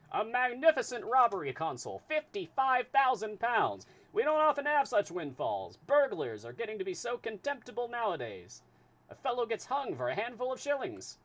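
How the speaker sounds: put-on high, loud transatlantic accent; booming high transatlantic detective; transatlantic voice for Fix. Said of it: "need a voice for Fix".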